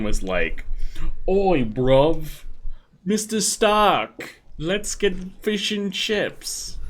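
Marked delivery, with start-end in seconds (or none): 1.3-6.8 slow, higher hammed-up British accent; exaggerated British accent, slowly; mock British accent. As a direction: drawl it out as a cartoon Brit. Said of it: "That's my British accent".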